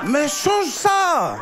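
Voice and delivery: high, loud, drawn-out retort; exasperated shout. Acted. Comedian telling the Congolese man to fix his vowels, shouting in exasperation. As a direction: stretch it into a high-pitched exasperated cry.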